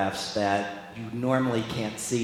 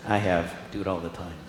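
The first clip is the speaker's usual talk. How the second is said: ordinary talk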